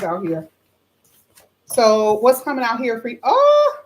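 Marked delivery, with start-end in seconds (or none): 3.2-3.8 sudden high loud oh of surprise; surprised gasp. Real, not acted. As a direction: react like the card just shocked you. Sudden reaction to drawn card.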